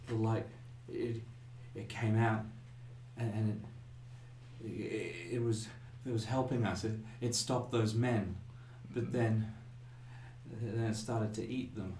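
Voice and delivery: quiet, halting words with long pauses; shaken, traumatised girl. Acted. Shaken girl coaxed to tell what the light did, halting and quiet. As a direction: speak softly and brokenly, struggling to get it out. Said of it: "coax a few more words out of her".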